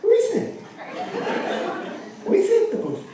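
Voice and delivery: very high indignant disbelief; incredulous echo. Acted. Dame to partner, squeaking in mock outrage at the credit. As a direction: pounce on the word we.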